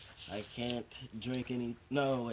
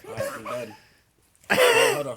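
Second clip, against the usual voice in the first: excited; 16 dB louder than usual. Real, not acted. Cinnamon burning, reacting.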